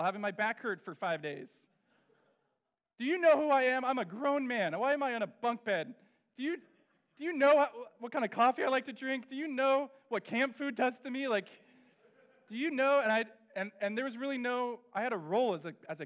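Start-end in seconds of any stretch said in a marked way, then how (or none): 3.0-3.8 louder, higher, hammed-up grievance; mock tirade about the bunk bed, loud and high; indignant outburst. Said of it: "wanted to go to the Young Life staff and say". 3.8-13.0 louder, higher, hammed-up grievance; mock tirade about the bunk bed, loud and high; indignant outburst. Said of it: "wanted to go to the Young Life staff and say".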